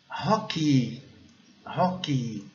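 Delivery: "hockey" with breathy English H and strong final ee; English pronunciation demo. Shown as a demonstration. Teacher models the word for students, careful English pronunciation. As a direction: model "hockey" the English way, H sounded, long I at the end. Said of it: "Mas a pronúncia correta".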